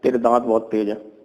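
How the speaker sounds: ordinary delivery, nothing marked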